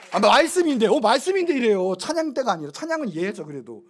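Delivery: usual speaking voice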